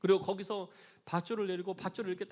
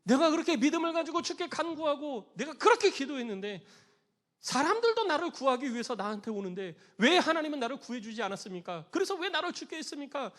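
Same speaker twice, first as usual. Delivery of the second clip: loud, raised-pitch protest; acted aggrieved outburst; pouring out resentment; 4 semitones higher, 7 dB louder than usual. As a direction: complain bitterly to God, demanding why. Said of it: "하나님을 향해서 막 원망을 퍼붓는 거예요".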